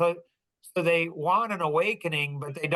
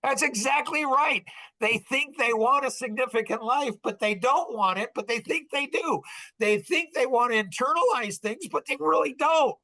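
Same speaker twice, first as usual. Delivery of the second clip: excited agreement; 8 semitones higher than usual. Real, not acted. Agreeing heatedly with the co-host.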